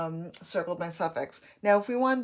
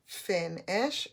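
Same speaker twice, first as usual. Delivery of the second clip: FIN-ISH split into fin and ish; syllable by syllable. Shown as a demonstration. Teacher scooping syllables, FIN-ISH in two parts. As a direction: mark two separate beats in FIN-ISH. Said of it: "my syllables".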